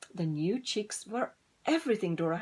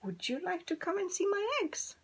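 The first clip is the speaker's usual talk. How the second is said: much higher hen voice, asking; clucking hen; 11 semitones higher than usual. Acted. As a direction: cluck the question brightly. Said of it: "she clucked".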